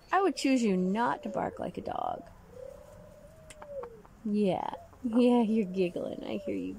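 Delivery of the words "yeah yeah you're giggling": usual speaking voice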